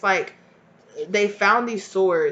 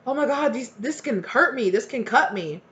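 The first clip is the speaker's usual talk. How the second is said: put-on higher-pitched shocked child; mock alarm; 4 semitones higher than usual. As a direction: act a kid suddenly realizing the danger. Acts child who cut themselves.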